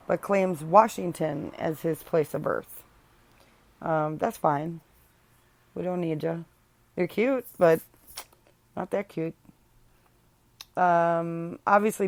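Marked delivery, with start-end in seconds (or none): none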